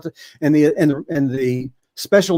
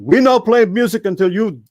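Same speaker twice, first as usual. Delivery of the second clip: a hint of a Jamaican accent, pitched up; softened Jamaican voice, a little higher; lightly voiced Jamaican man; 5 semitones higher, 3 dB louder than usual. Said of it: "and he's going".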